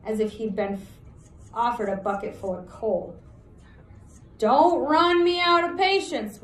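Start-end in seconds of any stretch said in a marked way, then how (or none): 4.4-6.4 loud, higher threat from the outlaw; warning. Acted. Huge outlaw warns the prince, loud and menacing. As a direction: bark it as a warning. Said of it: "warned the huge outlaw".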